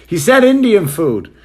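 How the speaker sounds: high, loud put-on clerk voice; mocking clerk voice. Clerk telling the other clerk, in a high mocking voice. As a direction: sneer it through a laugh. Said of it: "And he goes".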